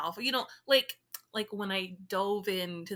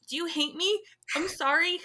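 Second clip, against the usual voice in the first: high-pitched, mock-anxious apology; anxious pleading; 7 semitones higher than usual. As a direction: plead in a higher, worried voice. Anxious reply to an emoji.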